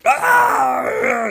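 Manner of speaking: high, loud agonized scream. Acted. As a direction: scream as if mortally wounded. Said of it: "screams Necron".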